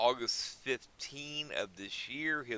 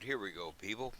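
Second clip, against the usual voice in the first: normal talking voice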